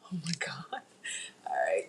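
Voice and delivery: breathy whispered aside. Real, not acted. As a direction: whisper it under your breath. Overwhelmed by chat comments.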